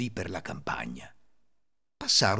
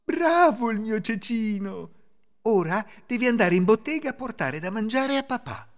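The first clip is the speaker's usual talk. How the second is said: pleased mother; 9 semitones higher, 4 dB louder than usual. A narrator's reading. Mother sending her tiny son to the shop, pleased. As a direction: praise the little one in a raised voice. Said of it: "La donna fu tutta felice".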